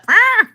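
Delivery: sudden high, loud scream; short shriek as a comic sound effect; mock goat scream.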